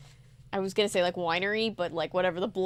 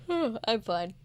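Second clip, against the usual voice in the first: normal talking voice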